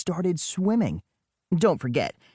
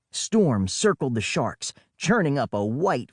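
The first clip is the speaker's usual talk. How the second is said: usual speaking voice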